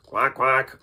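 loud double quack; duck quack. Shown as a demonstration. Animal warm-up for the voice, duck quacks. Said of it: "How about a duck?".

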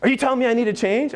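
high-pitched indignant outburst; upset. Retold or imitated. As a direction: retell her offended, incredulous challenge. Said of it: "she got upset".

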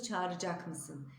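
Çağıracak mısın as çağırcak mısın; spoken form. Shown as a demonstration. Teacher models the spoken form, çağırcak mısın. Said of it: "diye okuyoruz".

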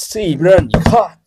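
plain reading voice

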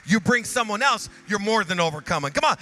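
high, loud shouted exhortation; shouting preacher at the peak; full-force preaching climax. Acted. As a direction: build the crowd to a roar.

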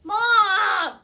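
loud, high outraged cry of Mom; loud protest. Real, not acted. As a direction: yell it in protest.

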